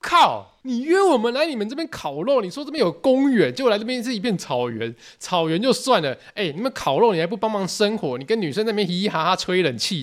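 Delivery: high, heated venting; indignant rant. Retold or imitated. Venting at the classmate who called them dirty, high and heated. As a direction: list every insult he dealt us. Ranting at the rude host.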